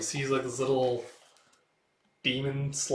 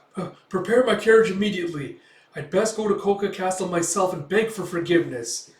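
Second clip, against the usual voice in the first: higher, louder hurried official; lightly voiced anxious mayor; 6 semitones higher, 7 dB louder than usual. The mayor orders his carriage, lightly voiced and anxious. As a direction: fuss over the carriage in a lightly hammed-up panic.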